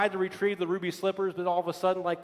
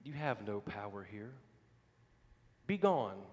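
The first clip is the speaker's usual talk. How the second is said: soft, low, unbothered; quietly serene, dismissing the threat; good witch calmly facing the wicked witch; 9 semitones lower, 8 dB quieter than usual. Retold or imitated. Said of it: "she never loses a smile".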